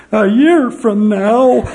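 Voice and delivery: high, loud and slow, breaking into laughter; laughing through it. Retold or imitated. Mission president retelling homesick elder's words, laughing hard. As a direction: quote the weeping elder while cracking up. Crying elder repeats the promise.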